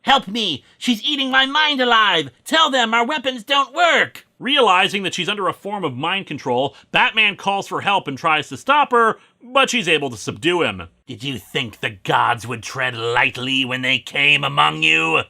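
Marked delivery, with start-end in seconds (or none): 0.0-4.3 high-pitched acted cry for help; Kraken yelling. Mind-controlled Lantern begging Batman for help, high urgent yell. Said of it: "yells at Batman". 11.1-15.2 ominous put-on villain voice; possessing god. Evil god speaking through a possessed Lantern, ominous put-on voice.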